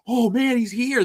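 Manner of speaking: gasping, high-pitched mock fan thrill; excited fan. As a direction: play a starstruck fan spotting him. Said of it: "Less people are gonna go".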